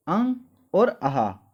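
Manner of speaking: अंग और आहा, said slowly; slow and clear. Shown as a demonstration. Teacher repeating both sounds slowly for the class. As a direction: spell it out slowly. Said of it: "कौन कौन सी?".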